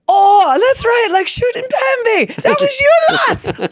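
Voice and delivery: very high, loud mock-Irish squeal; bad Irish accent. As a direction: shout it in a hammed-up Irish accent. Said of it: "apologies for my bad Irish accent".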